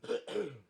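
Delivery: real harsh cough. Real, not acted. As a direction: cough it out again. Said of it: "Gotta edit that out".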